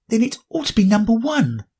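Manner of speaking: put-on high girlish voice; Alice, high and polite. Alice retorts.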